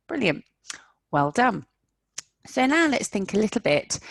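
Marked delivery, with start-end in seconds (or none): none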